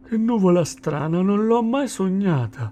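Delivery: high, uneasy sloth voice; wary wonder. A narrator's reading. Sloth eyeing an angry storm cloud, wary wonder. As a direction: puzzle aloud, slightly worried.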